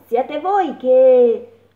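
slow, loud mole call. A narrator's reading. The mole calls to the flies, slow and loud. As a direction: stretch the words calling over.

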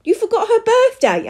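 very high, loud disbelief; horrified question. A narrator's reading. Husband blames his wife, horrified. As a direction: ask it aghast, pitched right up. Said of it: "looking at his wife in horror".

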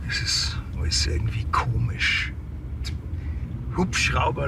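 murmured to himself; whisper. Acted. Staller muses about the case in the car, whispered. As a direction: think aloud in a whisper.

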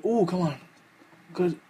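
ordinary delivery

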